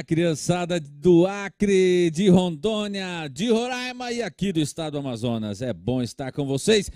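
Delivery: ordinary talk, nothing marked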